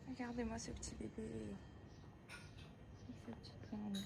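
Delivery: whispered adoring aside; tender whisper. Real, not acted. Showing viewers the cat, tender whisper. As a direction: hush so the cat stays calm.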